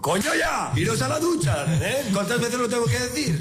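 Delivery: put-on high, exasperated shout at the kids; retold furious yell. Father yelling at his kids to get in the shower, exasperated shout. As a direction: bellow it like a father at the end of his rope.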